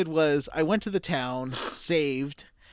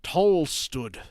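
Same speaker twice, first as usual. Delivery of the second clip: fading Devon accent; 5 semitones lower, 4 dB quieter than usual. Shown as a demonstration. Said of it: "now I'm losing my Devon".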